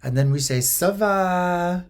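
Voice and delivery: put-on lightly French-accented shop woman, slow and patient; French pharmacist. French pharmacist teaching Carol the greeting, slow French lilt. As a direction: school the tourists gently in a French lilt. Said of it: "the woman says".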